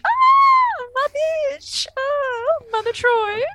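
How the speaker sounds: high, loud fangirl shriek; shrieking with glee; delighted squeal. Retold or imitated. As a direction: squeal with delight at a favourite character. Gushing over Lwaxana's entrance.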